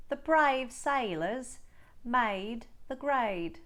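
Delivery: Australian A drill, slow sentences. Shown as a demonstration. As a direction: say each A nearly like I. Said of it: "Let's try some more".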